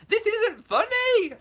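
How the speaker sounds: high, loud, slow wail; hammed-up tragic protest; mock outrage. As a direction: melodramatic high cry. Mock despair at laughing viewer.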